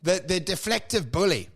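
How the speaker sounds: ordinary talk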